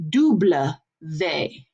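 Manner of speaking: "double V" as "doo-bluh vay"; French "double vé", word by word; French letter W. Shown as a demonstration. Teacher showing French W, "doo-bluh vay". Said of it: "a W really is two V's".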